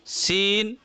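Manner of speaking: सीन with long pure "ee", seen; maruf vowel. Shown as a demonstration. Teacher models the accepted reading, सीन in maruf "ee". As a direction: stretch the "ee" in सीन. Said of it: "मारूफ पढ़ेंगे।".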